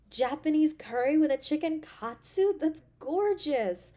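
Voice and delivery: high, loud gushing over the food; thrilled delight. Acted. Girlfriend gushing over the dinner her partner cooked, thrilled and loud. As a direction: squeal with delight at every detail.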